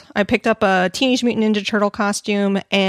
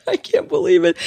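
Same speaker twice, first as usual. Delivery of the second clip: usual speaking voice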